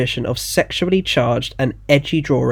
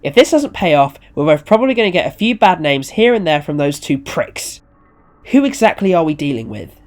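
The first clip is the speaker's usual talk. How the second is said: mildly heated, raised questioning; exasperated demand; 5 semitones higher, 6 dB louder than usual. Acted. Owner demanding to know who the client is, exasperated. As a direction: press for an answer, somewhat exasperated.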